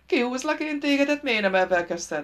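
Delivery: high, loud village fool heaving; straining eagerness. Acted. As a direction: start way up high, tugging eagerly. Fool heaves the trapped man.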